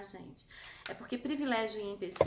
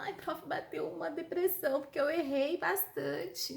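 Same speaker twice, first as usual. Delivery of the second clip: mock sad student; 5 semitones higher than usual. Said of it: "você fala".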